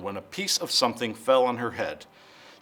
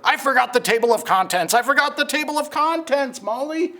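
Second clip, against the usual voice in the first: flustered narrator; 12 semitones higher, 4 dB louder than usual. Acted. Jack the narrator panicking.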